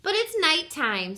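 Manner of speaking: high, loud little squeak. A narrator's reading. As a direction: squeak it like a worried child. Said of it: "Stella Luna squeaked".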